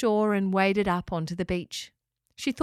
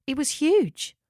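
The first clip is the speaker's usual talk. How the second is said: ordinary delivery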